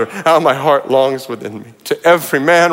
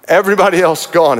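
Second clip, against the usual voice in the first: usual speaking voice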